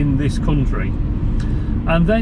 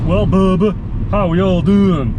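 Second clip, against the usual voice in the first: mock American accent. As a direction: put on a hammed-up American twang. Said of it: "That's like me saying to an American, "Oh, you're from L A".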